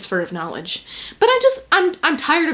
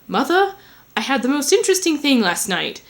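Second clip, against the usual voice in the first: lightly put-on English accent, pitched up; 4 semitones higher than usual. Son tells mother his news, mock English accent. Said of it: "where my English accent went".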